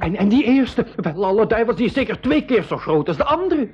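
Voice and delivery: high, startled cry of alarm. Acted. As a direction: gasp it out in fright at the crocodiles. Boy sees huge lead crocodile.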